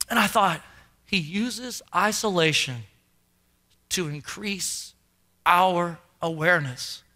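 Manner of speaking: low, subdued preacher's voice; hushed sermon reflection. A narrator's reading. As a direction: let each phrase land quietly. Solemn reflection on Peter singled out.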